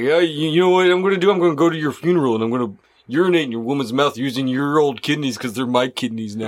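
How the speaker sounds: fast, low menacing killer; serial killer voice. Acted. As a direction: gloat quickly and darkly. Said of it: "That's a good serial killer voice".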